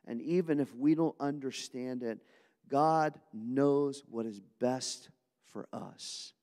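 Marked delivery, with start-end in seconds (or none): 2.7-6.3 slow emphatic. Shown as a demonstration. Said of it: "Everybody say amen to that".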